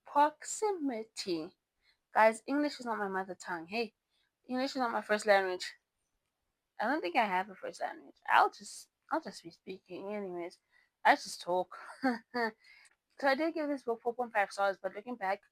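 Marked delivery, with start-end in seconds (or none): 0.1-1.5 "proximity" said slowly, syllable by syllable, pitched up; slow deliberate "proximity"; careful pronunciation. Real, not acted. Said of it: "I can't say this word". 11.8-12.5 short sheepish laugh; light self-mocking giggle; embarrassed laugh. Real, not acted. Laughing at own flub.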